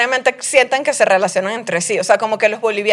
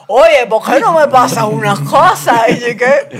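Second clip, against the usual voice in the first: exaggerated Venezuelan accent, drawn out slowly; mock Venezuelan accent; 2 semitones higher, slower than usual. Venezuelan mimics how Mexicans imitate her, hammed-up Venezuelan accent. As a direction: parody a bad imitation of Venezuelan, stretching the words. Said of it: "cuando me quieren imitar".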